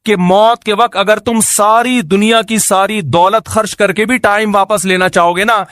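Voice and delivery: usual speaking voice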